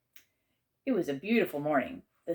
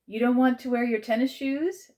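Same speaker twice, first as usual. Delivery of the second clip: higher, lifted prodding question; gentle prodding; 8 semitones higher, 6 dB louder than usual. A narrator's reading. Aunt hinting at tennis shoes, gently prodding. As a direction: nudge with a leading question. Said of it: "in the gentle, prodding tone".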